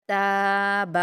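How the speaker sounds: تاب with تا long and با quick; stretched ta. Shown as a demonstration. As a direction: model it slowly. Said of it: "تا پر الف مدہ ہے". (تا کو کھینچ کر، با جلدی)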